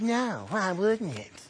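slow, drawn-out asking why; slow bewildered reply; slow puzzled question. Retold or imitated. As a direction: stretch it out, baffled. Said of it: "I said".

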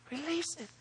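high, very soft near-whisper; hushed answer. Real, not acted. Preacher answering what church never does, hushed high voice. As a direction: answer your own question in a tiny hush.

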